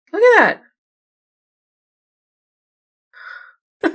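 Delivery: thrilled squeal into a laugh; excited high exclamation. Real, not acted. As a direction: blurt it out in delighted surprise. Excited at spotting something in photo.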